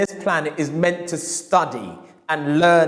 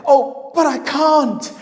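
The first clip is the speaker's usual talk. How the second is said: higher, slow, pained; anguished refusal; 10 semitones higher, 3 dB louder, slower than usual. Retold or imitated. Customer refusing the forbidden book, anguished. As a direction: draw out the denial.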